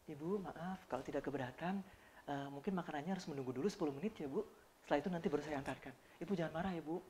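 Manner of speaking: very soft, gentle apologetic service voice; soft polite steward. Retold or imitated. Steward gently telling a passenger the food is delayed, very soft. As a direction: murmur the apology tenderly to the guest. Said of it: "Yang ngomong gitu ternyata bukan ibu-ibu".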